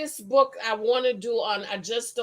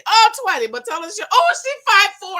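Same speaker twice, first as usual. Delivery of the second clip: surprised exclaim; 8 semitones higher, 8 dB louder, faster than usual. Real, not acted. Surprised at viewer's height.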